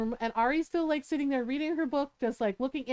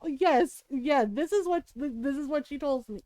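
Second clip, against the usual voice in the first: ordinary talk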